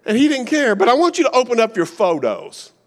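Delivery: ordinary talk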